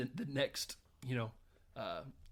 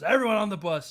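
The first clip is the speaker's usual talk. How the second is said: loud call; 7 semitones higher, 11 dB louder than usual. Retold or imitated. Chris Rock driver calling out.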